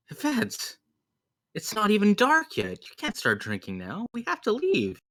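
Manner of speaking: high-pitched, scandalized objection; shocked protest. Acted. Acted scolding of Yvette's drinking.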